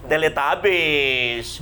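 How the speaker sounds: "teletabis" stretched out very slowly; drawn-out Teletubbies. Shown as a demonstration. Pastor warns couples off fighting, slowly stretched word. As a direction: draw "teletabis" out for comic weight. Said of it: "Tidak bisa seperti teleta".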